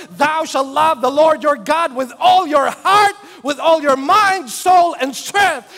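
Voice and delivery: proclaiming scripture. Retold or imitated. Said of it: "here's the commandment of the Lord Jesus Christ".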